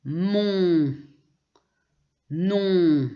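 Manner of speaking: mon, non with long nasal vowels and silent final N; nasal ON examples. Shown as a demonstration. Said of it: "on prononce". (« mon, non » allongés, la voyelle bien nasale)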